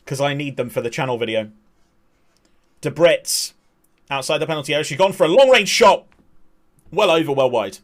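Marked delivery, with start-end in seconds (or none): 2.8-7.8 louder burst on the long-range shot; excited call of a shot. Acted. Commentator calling a long-range effort, excited. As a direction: rise with the shot, then settle.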